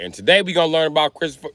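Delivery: ordinary delivery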